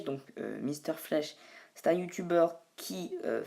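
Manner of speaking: normal talking voice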